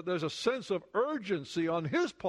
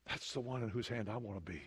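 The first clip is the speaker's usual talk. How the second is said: hushed; 7 semitones lower, 8 dB quieter, faster than usual. Real, not acted. Hushed intimate aside.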